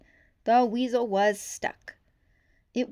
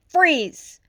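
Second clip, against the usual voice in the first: loud high command; cop's shout; 8 semitones higher, 12 dB louder than usual. A narrator's reading. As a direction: bark it like an order. Cop ordering suspect to stop.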